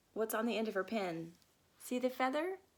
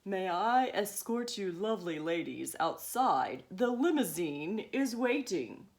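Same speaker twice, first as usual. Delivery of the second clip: lower, grand chauffeur voice; lightly posh dad; 5 semitones lower than usual. Acted. Dad escorting the ladies, lower and grand. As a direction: put on a grand gentleman.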